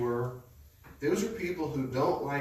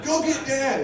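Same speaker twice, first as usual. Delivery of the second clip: put-on much higher, loud panicked child's yell; loud, high imitated plea from a scared kid; kid's cry for help; 9 dB louder than usual. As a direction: imitate the stuck brother begging. Brother stuck, crying for help.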